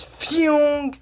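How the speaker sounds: high-pitched "phew" whoosh; high mock flying-off noise; imitated whoosh. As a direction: voice something zooming away.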